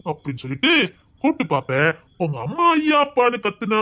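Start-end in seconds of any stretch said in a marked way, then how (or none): 2.2-3.8 mock yell. Mocking the mother's shouts.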